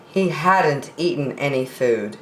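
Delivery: usual speaking voice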